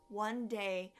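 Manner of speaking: ordinary delivery, nothing marked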